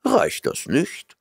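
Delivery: ordinary delivery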